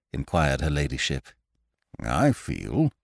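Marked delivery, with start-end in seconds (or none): 2.0-2.9 put-on elderly lawyer voice. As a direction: speak like a careful old solicitor.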